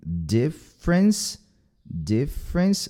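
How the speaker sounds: DIFFERENCE as two beats, "dif-rence"; short I, then straight into "frence"; two syllables. Shown as a demonstration. Teacher models for learners, two-syllable pronunciation. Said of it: "duas sílabas".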